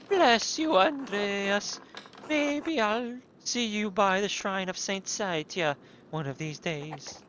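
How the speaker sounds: high, slow blessing; character voice. Acted. Villager greeting Andreas, high and slow. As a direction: bless him in a lifted, slow voice. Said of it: "these fucking wild accents".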